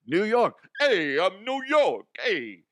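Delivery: mock New York accent. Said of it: "I'm like".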